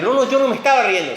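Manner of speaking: acted-out defensive blurting; hasty denial. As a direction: deny it fast, as if caught out. Sara denying that she laughed.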